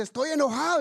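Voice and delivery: mock angry huff; indignant complaint. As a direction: grumble at Peter like a wronged man. Angry drowned man in heaven.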